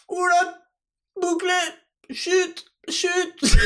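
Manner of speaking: high mock hush; playful high shushing. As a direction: shush them in a high put-on voice. Shushing the audience.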